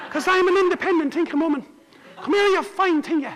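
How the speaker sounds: put-on high, breezy independent girl; high princess voice. Princess listing her plans to the king in a high voice. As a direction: rattle it off high and carefree. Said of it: "And she said".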